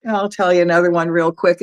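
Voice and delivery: plain reading voice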